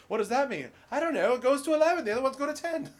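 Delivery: high, loud mock-British back-and-forth; comic British impression, pitched high; bad British accent. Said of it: "That's my horrible British accent".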